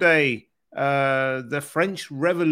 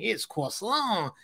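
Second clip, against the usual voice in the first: drawn-out French "croissant", pitched up; mock French accent; 6 semitones higher, 3 dB quieter, slower than usual. Host teasing co-host about French words, mock French accent. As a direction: tease with a slow, higher French accent. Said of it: "Next, you'll be saying".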